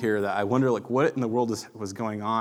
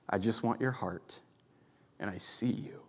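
softer and softer, ending in a true whisper; 9 dB quieter than usual. Acted. Pastor voicing what God whispers to him, whispered. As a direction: let God's words fade into a tender whisper.

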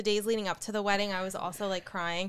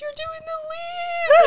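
very high, loud, slow wail; mock wailing sob; 10 dB louder, much slower than usual. Bride wailing at dad about the leaves, mock sob. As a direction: blubber it out through mock tears. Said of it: "I'm like".

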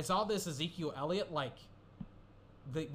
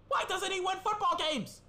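much higher and louder, fed up; exasperated shout; 5 dB louder than usual. Real, not acted. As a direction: throw up your hands and yell it. Exasperated over Ryan's record.

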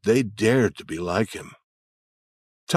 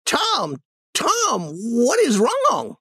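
put-on worried old lady; much slower than usual. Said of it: "she cried".

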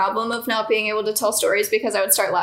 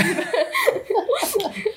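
squealing laugh; high burst of laughter; 11 semitones higher than usual. Real, not acted. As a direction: let out a high giggle. Laughing at own story.

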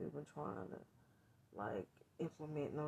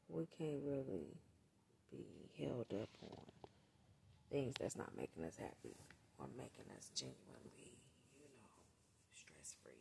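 soft whisper; 4 semitones lower, 10 dB quieter than usual. Real, not acted. Soft advice to viewers.

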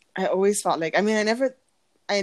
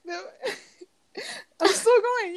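ordinary talk

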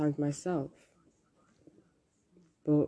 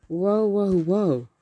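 loud, higher drawn-out whoas; mock alarm; 5 semitones higher, 8 dB louder, much slower than usual. Reacting to own panic about a Discord chat, mock alarm. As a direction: call a loud halt, hammed-up.

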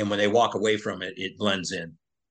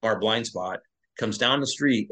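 normal talking voice

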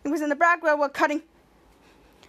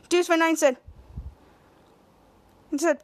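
ordinary talk

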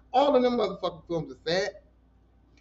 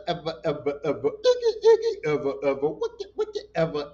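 silly sing-song. Pretend. Playful nonsense sing-song.

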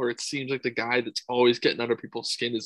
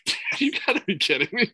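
excited disbelief; 10 semitones higher than usual. Retold or imitated. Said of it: "I'm like".

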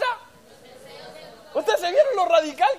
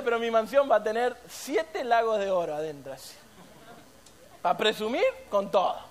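ordinary delivery

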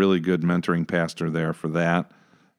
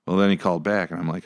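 normal talking voice

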